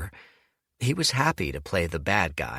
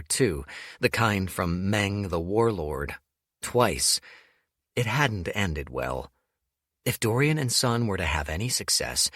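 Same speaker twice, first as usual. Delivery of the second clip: ordinary delivery, nothing marked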